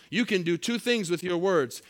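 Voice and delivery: ordinary delivery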